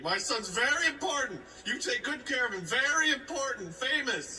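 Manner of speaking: high-pitched, emphatic mother; insistent proud mom. Retold or imitated. Mom to hospital staff about her son, high and insistent. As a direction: imitate a fussing, boastful mom.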